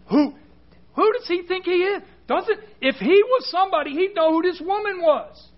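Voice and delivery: high-pitched, affronted muttering to himself; indignant Pharisee. Acted. Preacher voices the Pharisee judging Jesus and the weeping woman, indignant and high. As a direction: play a scandalized Pharisee, pitched well up. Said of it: "the Pharisee, he's like".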